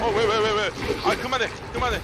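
frantic high screams of panic; panicked yelling. Real, not acted. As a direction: yell in terror as it grabs you. Chased and hit by monster.

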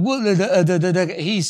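imitated stammer on short syllables; Moses stuttering. Preacher shows congregation how Moses stumbled over words, stuttering. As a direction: trip over the first sounds, repeating them. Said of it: "Moses stuttered".

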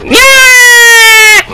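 extremely high sustained squeal; squealed yell. Real, not acted. Gamer mid-fight, very high squealed cry. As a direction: hold a piercingly high cry.

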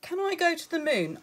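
high, fast, eager little cub; a small bear cub pleading to go to the moon. Acted. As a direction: ask like an excited small child. Little bear asks mother.

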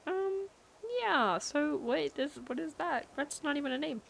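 high, soft puzzled reaction; acted-out baffled reply. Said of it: "and I'm like".

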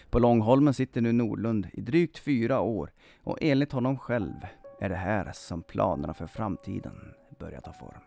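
ordinary delivery, nothing marked

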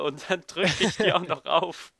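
slow, laughing through the words. Real, not acted. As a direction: giggle while admitting it. Laughing at own blunder.

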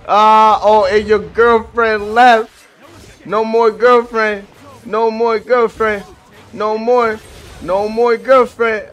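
gloating at the opponent who lost his partner; singsong gloating. Real, not acted. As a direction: rub it in slowly. Slow singsong mockery.

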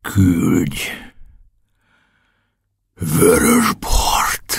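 put-on drunk. Drunk captain ordering wine.

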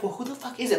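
quiet retold aside; hushed backseat aside. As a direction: say it under your breath, as if from the back seat. Backseat aside out of officer's hearing.

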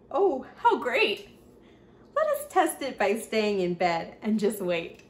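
ordinary delivery, nothing marked

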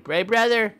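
high, loud whiny little brother; bratty brother's loud high whine. Pretend. Mock sibling fight in game.

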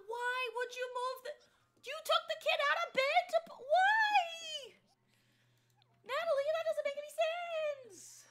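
very high, loud shriek; exasperated screaming. Real, not acted. Player scolds Natalie for moving the sleeping kid, exasperated screaming. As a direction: scream in disbelief at full pitch.

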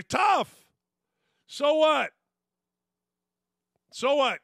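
loud, high "tough, so what"; defiant shout. Real, not acted. Host shouting down the complainers, loud and dismissive. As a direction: bark it back dismissively.

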